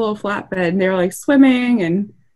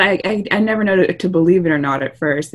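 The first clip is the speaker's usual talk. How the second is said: normal talking voice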